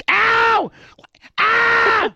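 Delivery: sharp loud cry of pain; pained yelp. Retold or imitated. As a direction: yelp like your arm is twisted back. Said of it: "she immediately, like".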